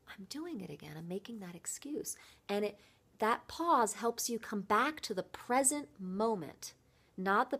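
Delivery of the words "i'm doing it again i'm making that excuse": much softer, a caught-myself hush; sudden quiet realization. Shown as a demonstration. Coach showing how to catch an excuse, softly. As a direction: drop the voice as if catching yourself mid-habit. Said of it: "pause and be like".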